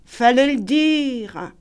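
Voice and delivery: slow, detached retort; slow waiter. A narrator's reading. Waiter answering Paul, slow unbothered reply. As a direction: drawl it indifferently.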